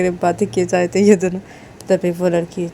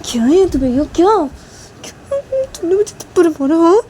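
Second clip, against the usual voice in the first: much higher, louder outraged question; indignant protest; 11 semitones higher, 4 dB louder than usual. Acted. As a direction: demand why you were slapped. Sneha protests the slap.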